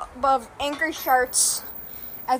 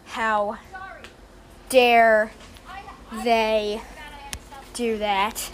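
slow, word-by-word hammed-up outrage; mock outrage; 4 semitones lower, much slower than usual. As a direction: spit out each word like a wronged mourner. Mock outrage over the memorial.

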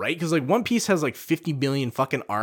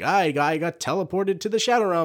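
high cartoon whine, imitated; mock-dramatic kids' dub voice, pitched up; Yugi; 7 semitones higher than usual. Imitating the four kids version, high mock-dramatic cartoon voice. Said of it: "he's like".

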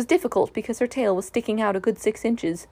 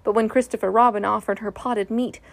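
usual speaking voice

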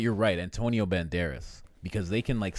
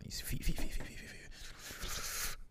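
suspenseful whisper; 10 dB quieter, slower than usual. Real, not acted. Describing the assassins' feet to a friend, whispered. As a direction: hush each feet, fading to nothing.

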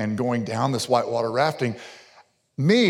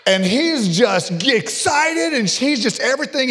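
mock excitement; 10 semitones higher, 4 dB louder than usual. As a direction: act out a relative's giddy excitement. Mimicking excited father-in-law's energy.

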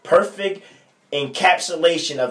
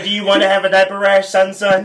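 mock sweet parent voice, higher and louder; 5 semitones higher, 7 dB louder than usual. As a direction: ham up a dad asking his baby. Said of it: "It's like".

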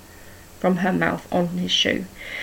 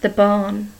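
ordinary delivery

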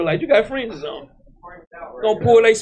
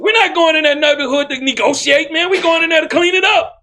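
high-pitched, shouted rallying cry; full-force preaching; 7 semitones higher than usual. Real, not acted. Preacher vowing to clean up the neighborhood, full force. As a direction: fire up the congregation, voice pitched high.

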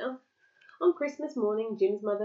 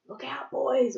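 mildly raised warning order; 5 semitones higher, 4 dB louder, slower than usual. A narrator's reading. Captain warning his boys, ordering. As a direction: call a warning to the men. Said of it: "ordered the captain".

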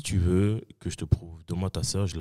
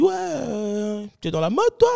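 imitating the guy; 13 semitones higher, slower than usual. Guy at party questions his clothes.

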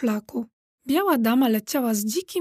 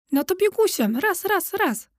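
brisk higher-pitched orders; imperious lady giving marching orders; commanding; 7 semitones higher than usual. A narrator's reading. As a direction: chivvy them along like a lady in command. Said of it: "komenderowała dama".

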